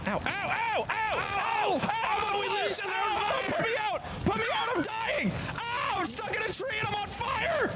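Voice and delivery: high hammed-up cries of burning pain; mock pain cries. Streamer voicing his burning game character, high mock yelps. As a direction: yelp like you're stuck burning in a tree.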